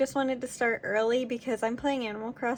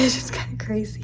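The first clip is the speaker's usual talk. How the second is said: laughing whisper. Real, not acted. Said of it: "I have to be quiet".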